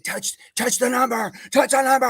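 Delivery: high mock yelling of an order; drill sergeant yell. Drill sergeant screaming at a short recruit, loud mock yell. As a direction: bark it like a drill instructor in his face. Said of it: "in my face screaming".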